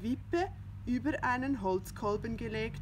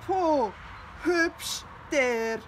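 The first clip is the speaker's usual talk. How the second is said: loud, high spat-out scorn; jealous cat hissing at the rooster; 6 semitones higher, 7 dB louder, much slower than usual. Acted. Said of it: "fauchte Findus".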